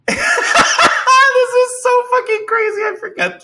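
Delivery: high, helpless laughing; slow words swallowed by laughter. Real, not acted. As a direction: squeal it out through laughter. Cracking up over own story.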